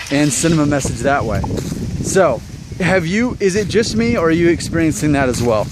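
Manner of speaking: ordinary delivery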